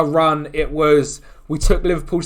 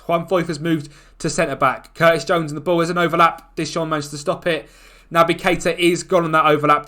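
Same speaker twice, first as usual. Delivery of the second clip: ordinary talk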